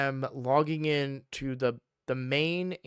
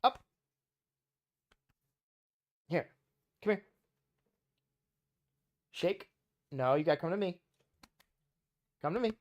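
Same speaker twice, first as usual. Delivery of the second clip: coaxing puppy voice; 5 semitones higher, 4 dB quieter, much faster than usual. Real, not acted. Calling puppy to the camera.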